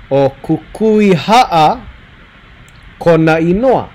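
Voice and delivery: O kukui ha'a drawn out very slowly; slow modeling. Shown as a demonstration. Teacher modeling answer slowly for students. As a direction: spell it out slowly for learners. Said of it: "teacher slows for students".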